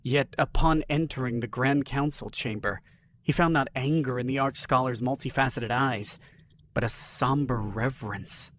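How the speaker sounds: ordinary delivery, nothing marked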